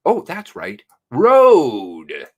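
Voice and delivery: ordinary delivery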